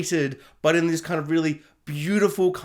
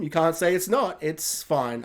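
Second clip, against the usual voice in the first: ordinary talk, nothing marked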